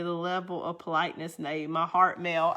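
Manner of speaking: ordinary talk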